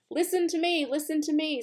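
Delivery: high, mock scream of a goose; shrieking for attention, but pitched high. Goose begging Wilbur to listen, high mock scream. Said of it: "screamed the goose".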